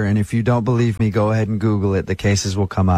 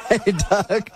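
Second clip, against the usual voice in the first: grinning high through laughter; laughing; 11 semitones higher than usual. Real, not acted. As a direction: call his name while laughing. Prank reveal, laughing.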